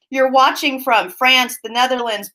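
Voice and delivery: normal talking voice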